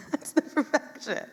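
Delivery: high, laughing repeat of the line; a bright laughing echo of that's the perfection. Real, not acted. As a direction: say it again through a delighted laugh. Repeats the line, second time laughing.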